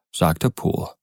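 usual speaking voice

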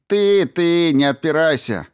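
loud, high accusation; angry shouting. A narrator's reading. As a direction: shout him down. Brin accusing in quarrel.

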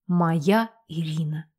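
tender. A narrator's reading. Werewolf says tenderly.

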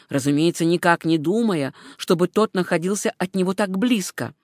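plain reading voice

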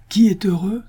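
loud, high rhetorical cry; sudden exclamation. A narrator's reading. Absalom seized by a sudden resolution, exclaiming. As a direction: burst out with new resolve. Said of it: "s'écria alors Absalom".

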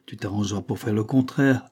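ordinary delivery, nothing marked

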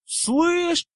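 high, loud call; shout. A narrator's reading. Ernie calling up at Goyle, shouting. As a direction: yell to get his attention. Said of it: "крикнул Эрни".

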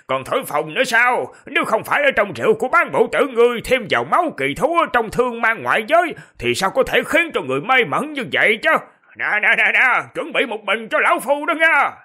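put-on high, loud teasing old man; mock a boastful friend, loud. Purple-robed elder voiced.

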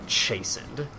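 usual speaking voice

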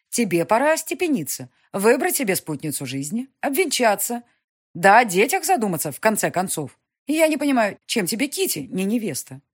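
властная графиня, громче и выше; мать поучает сына, с напором; напористая мать. Acted. Mother voiced by narrator. (властная мать, повышенным тоном)

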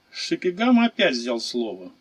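ordinary delivery, nothing marked

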